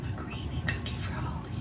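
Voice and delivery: whispered treat promise to the dog. Real, not acted. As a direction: breathe it to the dog as a secret.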